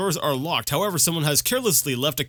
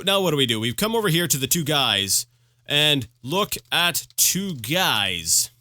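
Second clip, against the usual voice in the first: normal talking voice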